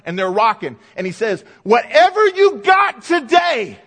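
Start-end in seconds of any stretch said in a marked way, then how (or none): 1.6-3.8 loud, high, stretched-out rallying cry; hammed-up locker-room pep talk; fired-up team rally. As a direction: imitate a football player rousing his teammates. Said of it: "they were fired up".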